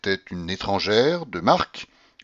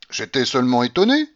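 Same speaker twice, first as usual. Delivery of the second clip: gaily; 4 semitones higher, 6 dB louder than usual. A narrator's reading. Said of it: "répondis-je assez gaiement".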